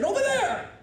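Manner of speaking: high, loud "over there"; mock shout. Worker snapping directions at him, a mock shout. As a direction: bark it like an impatient clerk. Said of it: "as she said".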